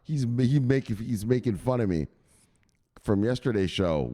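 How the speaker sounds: normal talking voice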